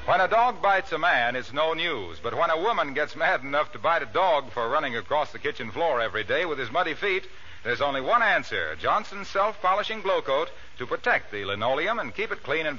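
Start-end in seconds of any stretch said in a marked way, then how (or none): none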